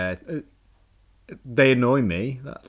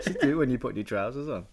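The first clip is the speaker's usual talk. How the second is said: ordinary delivery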